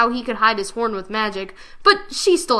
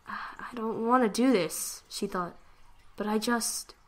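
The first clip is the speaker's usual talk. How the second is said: soft slow private thought; inner thought; 8 dB quieter, slower than usual. Acted. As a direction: murmur reluctantly to herself.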